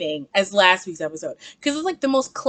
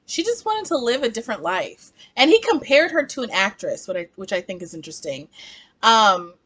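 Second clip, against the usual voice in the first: normal talking voice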